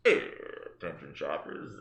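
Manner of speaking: low, slow cockpit announcement; a hint of airline pilot on the PA. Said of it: "oh no, I sound like a pilot".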